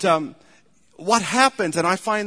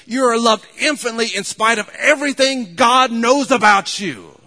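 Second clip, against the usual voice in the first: louder and higher, pressing each line to the congregation; preaching at full force; 5 semitones higher, 6 dB louder than usual. Acted. Preacher urging the congregation to look at the cross, loud, rising and impassioned. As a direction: build it up like a revival pulpit climax.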